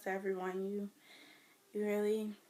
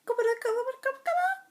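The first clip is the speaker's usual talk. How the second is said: Spanish woman calling; 8 dB louder, much faster than usual. Retold or imitated. Spanish people calling her.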